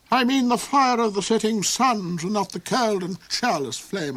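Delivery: high, loud, cold villain rhapsodizing; the Duke's high voice. Acted. The Duke raves to Hark about Saralinda, high and loud. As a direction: soar up high and gloat over her.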